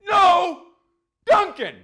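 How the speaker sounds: high, loud mock protest; mock outraged shout. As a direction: cry out in fake despair over Dunkin'. Mock protest at losing Dunkin'.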